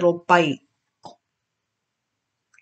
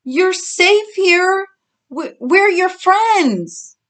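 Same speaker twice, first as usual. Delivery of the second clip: high, slow, loud reassuring call; 12 semitones higher, 5 dB louder, much slower than usual. A narrator's reading. Olivia to the frightened new pig, calling out. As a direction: coax someone out of hiding, voice raised high. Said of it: "Olivia called out".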